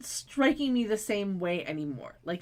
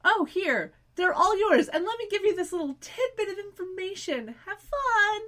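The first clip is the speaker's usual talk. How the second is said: much higher, mocking sing-song voice; sing-song taunt; 10 semitones higher than usual. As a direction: sweetly send them off to doom. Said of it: "It was a lovely little".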